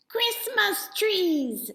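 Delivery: high, slow, gleeful troll cry; high squealing trolls cheering; excited troll shout. A narrator's reading. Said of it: "they shouted".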